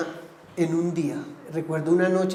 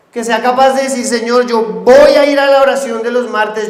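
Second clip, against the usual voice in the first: loud, higher, driving sermon voice; fiery preaching; 4 semitones higher, 6 dB louder than usual. Acted. Pastor exhorting the congregation to pray, fiery preaching. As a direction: preach it full force to the church.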